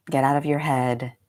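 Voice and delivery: normal talking voice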